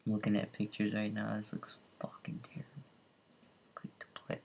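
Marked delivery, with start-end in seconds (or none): 1.4-4.4 hushed aside whisper. Real, not acted. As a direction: breathe it out under your breath. Reacting to screenshots of the game.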